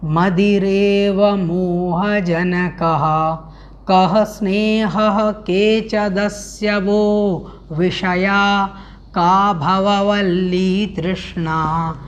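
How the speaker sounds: measured, slow recitation of the verse. Shown as a demonstration. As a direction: chant each word of the Sanskrit verse slowly. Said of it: "सर्वप्रथम इसका उच्चारण करेंगे".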